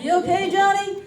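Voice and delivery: high, loud check-in to someone lagging; calling back. Acted. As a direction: call back over your shoulder to a straggler.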